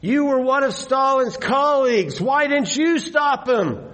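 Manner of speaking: high-pitched accusing call; heckler's shout. Acted. Heckler challenging Khrushchev in a public meeting, shouting. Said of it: "interrupted by a shout from a heckler".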